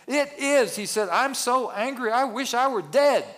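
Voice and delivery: high, loud furious whine; angry outburst. Acted. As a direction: explode with petulant anger. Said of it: "the Hebrew here actually rises to the level of an expletive".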